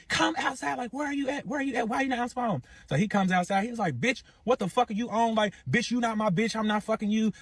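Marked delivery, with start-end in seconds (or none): 0.1-2.6 high-pitched retold yelling, little added volume; imitated door-pounding demand. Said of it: "She's beating on the door like". 4.0-7.3 retold arguing. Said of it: "So they arguing".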